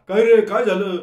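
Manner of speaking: a roommate yelling at the door; shouting. A narrator's reading. Roommate shouting at him in the doorway, loud. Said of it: "मान्या ओरडला".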